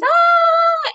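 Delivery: put-on high, loud squeal of a running toddler; imitated toddler scream, very high; imitating happy kid's squeal. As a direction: squeal like a gleeful little kid. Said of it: "when they're happy".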